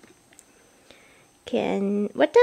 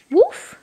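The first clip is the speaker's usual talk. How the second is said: put-on high, louder woof for a toddler; dog bark; 9 semitones higher, 5 dB louder than usual. Shown as a demonstration. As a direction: bark like a playful puppy. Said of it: "What does a dog say?".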